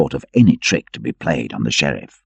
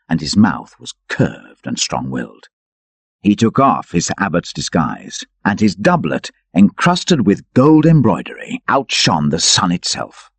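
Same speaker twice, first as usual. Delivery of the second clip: usual speaking voice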